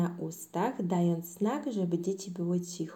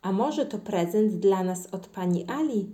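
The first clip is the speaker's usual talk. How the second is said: plain reading voice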